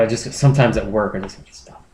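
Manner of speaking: ordinary talk, nothing marked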